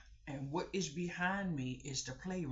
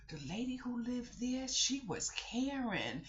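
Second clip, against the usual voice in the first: put-on high slow little-girl tattling; high child drawing out Karen; child gossip; 9 semitones higher than usual. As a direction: tattle like a kid.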